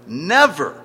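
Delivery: "never" hammered loud, peaking then falling; emphatic NEVER. Real, not acted. Preacher repeating the word he emphasizes, "never" stressed loudly. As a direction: drive "never" home forcefully. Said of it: "I emphasize the word never".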